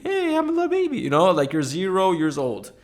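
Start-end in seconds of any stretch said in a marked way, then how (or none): 0.0-1.0 put-on squeaky baby voice; tiny high baby voice; very high pitch. Voicing a baby.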